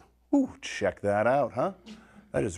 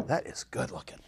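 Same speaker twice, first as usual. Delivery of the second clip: whispered praise; 3 semitones higher, 8 dB quieter than usual. Real, not acted. Admiring food softly.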